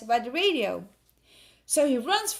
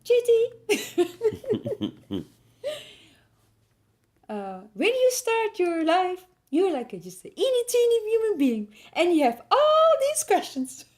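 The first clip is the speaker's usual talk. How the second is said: mock-solemn granny lecture; grandma voice; 8 semitones higher than usual. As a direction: play the wise grandmother, rising high. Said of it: "she says".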